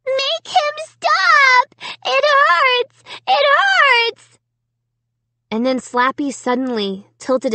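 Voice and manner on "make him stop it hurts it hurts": put-on very high, slow, agonized child cries; high-pitched desperate wail; wailing little girl. A narrator's reading. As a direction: bawl it like a small child in pain. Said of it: "heartbreaking wail".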